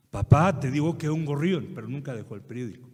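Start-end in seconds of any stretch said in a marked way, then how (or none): 0.1-1.7 impatient louder reply; annoyed son. Retold or imitated. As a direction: snap back without looking up. Son annoyed answering father again.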